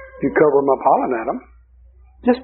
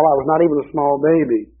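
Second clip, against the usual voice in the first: ordinary delivery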